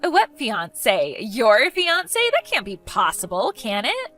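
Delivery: scandalised astonishment; stunned disbelief. Acted. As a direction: question it as if it cannot be true. Stunned by rival's engagement news.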